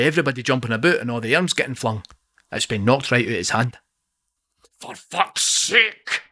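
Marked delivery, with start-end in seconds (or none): 4.8-6.2 much higher, strained outburst; roaring curse. Acted. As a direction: blurt it as the thing is knocked away. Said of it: "he roars".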